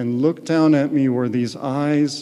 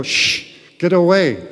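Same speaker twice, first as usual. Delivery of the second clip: hushed shh, then a louder, higher get away; shooing away; 4 semitones higher, 6 dB louder than usual. Retold or imitated. He shoos his doubting mind, hush then loud. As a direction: shush the mind, then push it off loudly. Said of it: "And I go".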